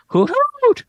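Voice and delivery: high hooting owl call; owlbear voice. Acted. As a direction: hoot it out like a giant owl. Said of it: "The owlbear hoots at you aggressively".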